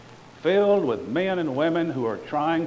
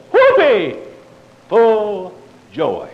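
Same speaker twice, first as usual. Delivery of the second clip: sarcastic cheer; 6 semitones higher, 10 dB louder, much slower than usual. Pretend.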